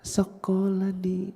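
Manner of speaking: put-on soft, high, slow little child voice; Umar. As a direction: answer small and hushed, drawn out. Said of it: "dia pun otomatis ngikut".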